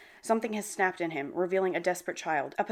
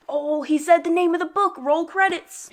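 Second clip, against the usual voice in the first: higher, louder title-drop squeal; fangirl shout; 9 semitones higher, 6 dB louder than usual. Real, not acted. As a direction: celebrate like the movie just ended.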